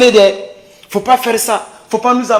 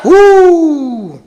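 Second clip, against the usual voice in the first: rally whoop; 7 semitones higher than usual. Acted. Rally cry.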